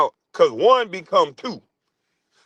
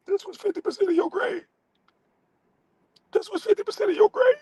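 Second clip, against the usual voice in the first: shrill falsetto taunt, very high and fast; squeaky mock voice; 5 dB quieter, faster than usual. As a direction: squeal it like a cartoon teacher. Mock high voice repeating line.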